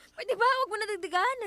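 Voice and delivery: exasperated. Acted. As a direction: cut him off, fed up. Couple quarrel after break-in.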